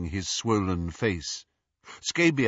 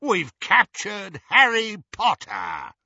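loud, slow, high werewolf roar; triumphant roar; 10 semitones higher, 5 dB louder, slower than usual. A narrator's reading. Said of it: "Greyback roared triumphantly".

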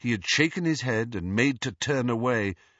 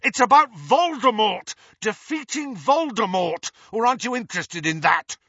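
raised, heated challenge, high and loud; shouting; 12 semitones higher, 6 dB louder than usual. A narrator's reading. Harry challenges the ghost about Voldemort, shouting. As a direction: challenge them at full voice. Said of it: "Harry shouted at her".

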